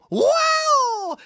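very high peacock screech; peacock cry. Acted. Storyteller voicing excited barnyard peacock, high cry.

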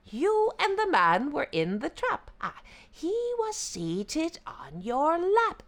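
high jackal voice; teasing mock puzzlement; sly yippy jackal. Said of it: "The jackal thought out loud".

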